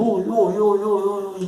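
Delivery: high-pitched string of hellos, hammed up; mock overeager greeting. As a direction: parody someone greeting everyone in sight. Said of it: "você vai sair falando". (cumprimento exagerado, em voz bem aguda)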